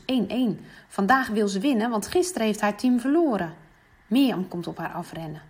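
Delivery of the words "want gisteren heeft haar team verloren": ordinary delivery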